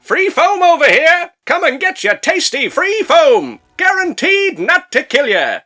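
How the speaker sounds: loud, high, drawn-out vendor's cry; hawker bug calling out. Acted. Foaming bug luring nearby roaches, high hawker's call. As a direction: slowly sing out the offer like a street seller. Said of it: "attracts all the nearby roaches like".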